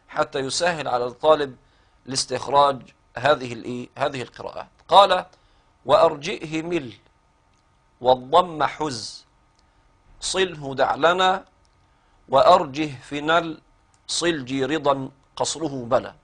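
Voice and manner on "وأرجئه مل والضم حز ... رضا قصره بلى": soft, drawn-out chanting of a poem line; slow verse recitation. Acted. Teacher quoting Abu Shama's verse to students in a slow chant. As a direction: recite the verse slowly and gently, savoring each part.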